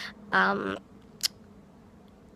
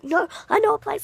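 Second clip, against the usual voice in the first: high, quick mimicked protest; imitating his insistence; 13 semitones higher, 4 dB louder, faster than usual. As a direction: mimic him hurriedly offering a fix. Imitating him desperately offering fixes.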